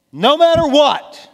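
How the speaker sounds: high, loud burst in one run; impassioned cry. Real, not acted. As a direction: cry it out from the pulpit without breaking between words. Same words, repeated as a cry.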